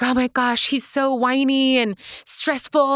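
high, slow, exasperated mom voice; whiny stressed mom. Retold or imitated. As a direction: mimic a frazzled mom complaining. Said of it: "They're like".

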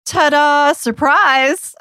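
high, sing-out ta-da; playful reveal. Pretend. Co-host unveils their new TikTok account, playful high reveal. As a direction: announce it like a surprise, pitched right up.

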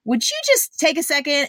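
ordinary talk, nothing marked

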